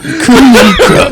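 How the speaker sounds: laughing through the promo line; hammy promo catchphrase, half laughing; wrestling promo. Pretend. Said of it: "let me do my promo".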